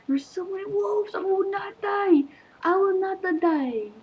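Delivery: high, frantic cries; panicked defiance. Real, not acted. Player swarmed by wolves, panicked defiance. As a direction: cry out in panic, pitch way up.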